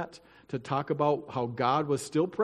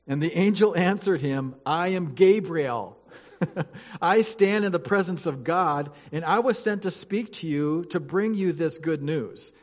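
louder, formal Bible reading voice; scripture reading; 3 semitones higher, 4 dB louder than usual. Acted. Pastor reading Luke to the church, formal and solemn. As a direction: read it out to the congregation with weight.

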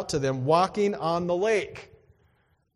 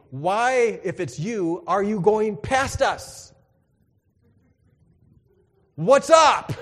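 higher, louder, slower incredulous question; puzzled challenge; 4 semitones higher, 6 dB louder, slower than usual. Retold or imitated. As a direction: demand it half-jokingly. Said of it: "I'm thinking".

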